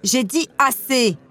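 angry outburst. A narrator's reading. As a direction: cut her off, stretching each word firmly. Sister snaps in an acted quarrel. (ordre exaspéré, appuyé et plus aigu)

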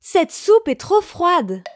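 exclaiming. A narrator's reading. Goldilocks tasting the second bowl of soup, exclaiming.

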